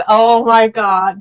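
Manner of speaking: slow, drawn-out joyful "oh my God"; overjoyed relief. Retold or imitated. Telling viewers how she learned she passed, slow stunned joy. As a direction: savor the best news of your life.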